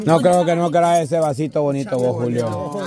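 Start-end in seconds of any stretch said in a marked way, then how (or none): none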